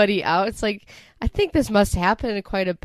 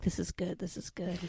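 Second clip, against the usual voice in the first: sly under-breath aside; 14 dB quieter than usual. Retold or imitated. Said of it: "winking at the producer".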